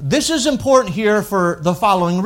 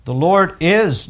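is stretched and weighted; emphatic is; slower than usual. Shown as a demonstration. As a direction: hit is hard and slow. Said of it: "notice those are emphatic terms".

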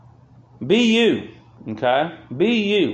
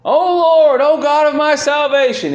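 high, loud anguished plea to God; crying out; 11 semitones higher, 5 dB louder than usual. Acted. Preacher voicing the psalmist's cry to God, crying out. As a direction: cry out with raised, sustained voice. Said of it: "he cried out".

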